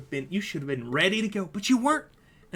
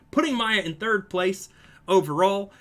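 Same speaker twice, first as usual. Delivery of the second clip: live play-by-play; 4 semitones higher, 8 dB louder than usual. Real, not acted. Streamer calling a marble race for viewers, lively play-by-play. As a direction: race commentary, rising with the action.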